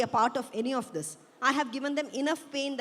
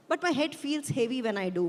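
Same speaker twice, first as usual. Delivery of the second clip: usual speaking voice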